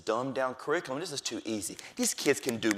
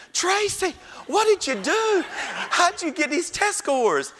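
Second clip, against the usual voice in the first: much higher, louder and slow; astonished principal; 5 dB louder, slower than usual. Retold or imitated. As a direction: drag out the amazement at the scores. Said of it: "And the principal said".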